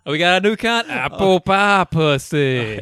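hammed-up strip club barker; carnival barker. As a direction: sell it like a sideshow barker. Imitating a barker's sales pitch.

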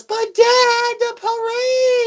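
hammed-up child whining; whiny kid voice. Son begging his dad not to leave, high whine. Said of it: "the son comes over and goes".

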